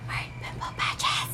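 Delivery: hushed, secretive whisper. Retold or imitated. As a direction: breathe it like a shameful secret. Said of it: "I said".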